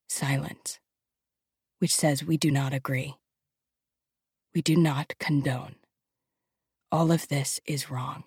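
soft, grave, measured hush; hushed narration. A narrator's reading. Narrator voicing the crowd's silent protest, hushed. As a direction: drop to a quiet, solemn tone.

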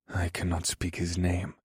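hushed, firm refusal; quiet but determined. A narrator's reading. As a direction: keep it very soft yet resolute. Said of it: "said in a quiet but determined voice".

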